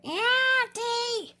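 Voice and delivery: very high mock call for auntie; retold scream. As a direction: imitate the kid shrieking for his aunt. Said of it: "I'm screaming for my aunt".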